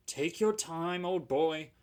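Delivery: slow, gentle soothing; calm him down, slowly. Acted. Driver soothing his tired engine, gently and slowly. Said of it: "soothed his driver".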